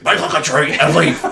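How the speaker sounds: laughing, put-on movie quote; scolding old man. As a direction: gleefully imitate the cranky correction. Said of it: "so hard for me not to say".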